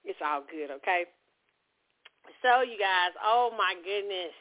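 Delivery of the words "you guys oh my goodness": ordinary talk, nothing marked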